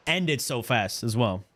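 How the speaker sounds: ordinary delivery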